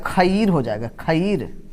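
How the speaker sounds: "खीर" said as kha-eer; wrong split reading. Shown as a demonstration. Teacher showing what a misspelling sounds like, "खीर" as kha-eer. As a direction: pronounce "खीर" in two syllables, kha then eer. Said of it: "ये क्या हो जाएगा".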